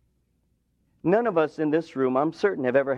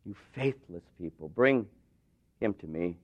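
weary Jesus; 9 semitones lower, 6 dB quieter than usual. Acted. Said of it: "And Jesus says".

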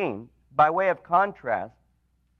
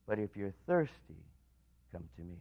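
much softer and lower, almost hushed; soft invitation; 9 semitones lower, 13 dB quieter than usual. Acted. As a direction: bring it down to an intimate plea.

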